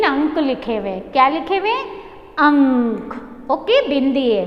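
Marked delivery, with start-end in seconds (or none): 2.4-3.5 "अंक" very slow with a long ng; pronunciation demo. Shown as a demonstration. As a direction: hold the hum in "अंक" before the k. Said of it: "तो हम उसे कैसे pronounce करेंगे?". ("अंक" में अनुस्वार लंबा खींचकर)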